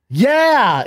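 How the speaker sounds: hammed-up loud agreement; loud retold yeah. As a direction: bark a mock-exasperated yeah. Retold exasperated reply at counter.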